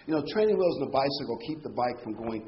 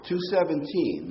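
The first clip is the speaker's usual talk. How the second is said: ordinary talk, nothing marked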